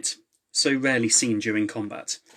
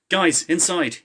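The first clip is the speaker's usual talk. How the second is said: higher and louder call; urgent call; 6 semitones higher, 5 dB louder, slower than usual. A narrator's reading. Someone calling the team inside, higher and louder. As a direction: hurry them in.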